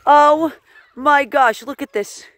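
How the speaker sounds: usual speaking voice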